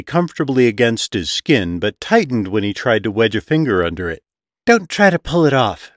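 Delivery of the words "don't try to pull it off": warning. A narrator's reading. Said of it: "Victor warned".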